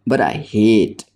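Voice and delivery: slow, hard stress on hate; fierce stress on hate. A narrator's reading. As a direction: lean into the word hate with a slow, fierce push. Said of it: "she emphasized the word almost fiercely".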